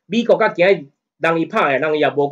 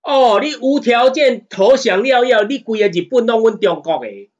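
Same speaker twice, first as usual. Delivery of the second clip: mocking imitation; 7 semitones higher, 3 dB louder than usual. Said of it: "迄支好笑嘴".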